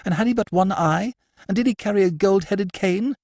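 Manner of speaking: high, pressing questions; eager lady. Acted. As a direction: lean in, voice pitched high.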